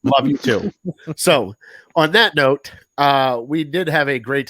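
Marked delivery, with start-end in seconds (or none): 0.0-0.7 laughing reply. Retold or imitated. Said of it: "I'm like".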